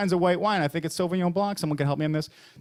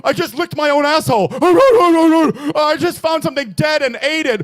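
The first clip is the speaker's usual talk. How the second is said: loud, high, far-off mock dog voice; loud disgusted rant; 9 semitones higher, 9 dB louder than usual. Comic acting out what dog breath says. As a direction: bellow it as the gross dog's thoughts.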